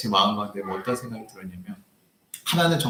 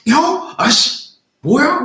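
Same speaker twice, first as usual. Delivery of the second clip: high, louder mock curse; retold cursing; 12 semitones higher, 7 dB louder, much slower than usual. Pastor retelling his cursing at the old man, loud. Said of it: "욕을 하면서".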